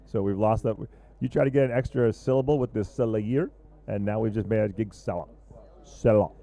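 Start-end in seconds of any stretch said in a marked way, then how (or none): 4.8-6.3 mock French. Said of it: "making everybody's last name French".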